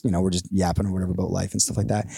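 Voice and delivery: usual speaking voice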